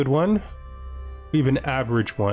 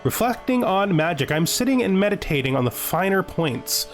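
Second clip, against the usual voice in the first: higher, measured storytelling voice; reflective, theatrical narration; in-character journal reading; 4 semitones higher than usual. Acted. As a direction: read it like a mage recalling a pilgrimage. Reads game character's journal aloud.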